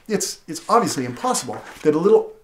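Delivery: ordinary talk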